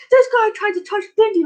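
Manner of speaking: high, indignant retold complaint; playfully replaying an angry voice memo; mock angry yell. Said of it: "and, like, yelling".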